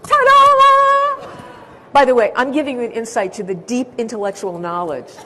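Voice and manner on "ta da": sung very high and loud fanfare. Shown as a demonstration. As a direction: announce it like a stage fanfare. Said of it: "fanfare reveal".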